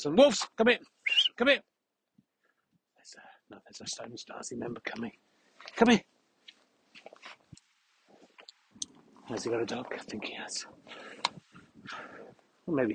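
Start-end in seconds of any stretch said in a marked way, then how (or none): 3.0-10.7 hushed, unvoiced whisper. Real, not acted. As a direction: whisper so he won't hear. Hiding from approaching Stone Stasi.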